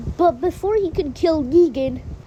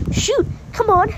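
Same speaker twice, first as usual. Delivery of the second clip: higher-pitched urgent goad; 6 semitones higher than usual. A narrator's reading. Alden to Alpha aiming a shotgun, urgent goading. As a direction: goad them to fire. Character urging a shot in story.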